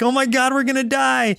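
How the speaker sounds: high-pitched mock panic; mock freaking out. Kids hearing the enemy's huge level, mock freaking out. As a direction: imitate kids in a sudden panic. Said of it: "they just start freaking out".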